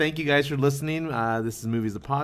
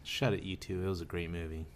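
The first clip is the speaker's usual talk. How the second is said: menacing; 6 semitones lower, 9 dB quieter than usual. Pretend. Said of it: "That sounded menacing".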